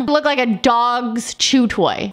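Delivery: ordinary talk, nothing marked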